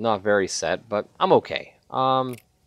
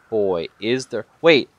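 usual speaking voice

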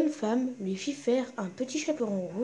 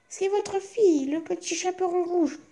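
put-on much higher child's voice; a small girl announcing herself, much higher; little girl's voice; 8 semitones higher than usual. Girl at the door answers the wolf in a high child's voice.

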